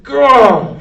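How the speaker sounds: loud, high frustrated gah; Mig shouting gah. A narrator's reading. Mig missing the mouse with the knife, loud frustrated gah. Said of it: "shouted Mick".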